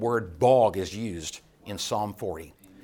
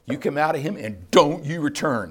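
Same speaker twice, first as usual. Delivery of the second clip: a firm, louder command to the demon; commanding rebuke; 4 semitones higher, 6 dB louder than usual. Retold or imitated. Jesus ordering the demon out, in a firm loud command. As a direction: cast it out with authority. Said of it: "Jesus says to the demon".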